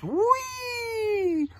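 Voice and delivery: high, long squeal of delight; gleeful snake. Acted. Snake gets thrown by friends, high held gleeful cry. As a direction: sail up high and hold it. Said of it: "The snake says".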